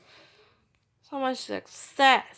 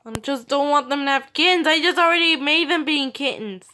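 panicked; 3 semitones higher, 7 dB louder than usual. Real, not acted. Panicking about kittens.

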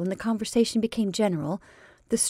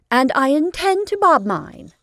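defiant; 8 semitones higher, 7 dB louder than usual. Acted. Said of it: "she said hurriedly".

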